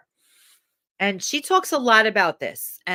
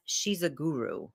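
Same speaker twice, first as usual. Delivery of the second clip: low, soft retold mutter; a quiet grudging murmur; muttered under breath; 5 semitones lower, 7 dB quieter than usual. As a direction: mutter it under your breath like a snide aside. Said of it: "under his breath".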